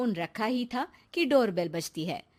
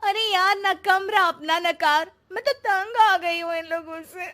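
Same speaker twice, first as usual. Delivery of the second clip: exasperated complaint; 4 semitones higher, 5 dB louder than usual. Acted. Sonia complains to husband on phone.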